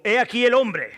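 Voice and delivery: high, loud public announcement; loud proclamation. A narrator's reading. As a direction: proclaim it to the assembled crowd. Pilato presenta a Jesús al pueblo. (proclamación en voz alta)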